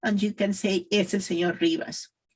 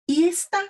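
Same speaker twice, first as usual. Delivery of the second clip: "¿Y esta?" pitched high, rising at the end; questioning intonation; 9 semitones higher, faster than usual. Shown as a demonstration. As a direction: lift "¿Y esta?" into an emphatic question.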